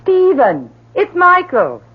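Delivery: high, loud happy call to the next room; excited call. Acted. Mother calls her husband that their son is home, excited loud call. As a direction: call out the good news.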